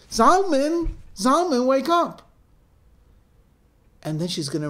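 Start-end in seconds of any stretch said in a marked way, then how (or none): 0.1-2.3 loud, high-pitched cry to wake someone; urgent calling out. Acted. Mother trying to wake her son, urgent shout. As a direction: shout to rouse a sleeper who won't stir.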